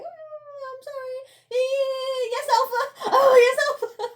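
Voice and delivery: high, laughing, cringey submissive voice; mock simpering whimper. Mocking the cowering girl who simpers to the alpha. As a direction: squeal it out like a cowering pick-me. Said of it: "It's so cringe".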